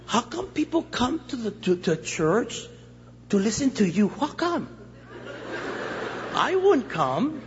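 comic skeptical mother, drawing out the end; doubting mother. Acted. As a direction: question it like an unimpressed mama. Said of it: "she says".

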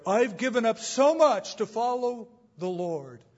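high, loud self-pitying complaint; whiny martyr. Retold or imitated. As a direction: moan like a martyr for sympathy. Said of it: "express it this way".

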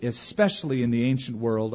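ordinary talk

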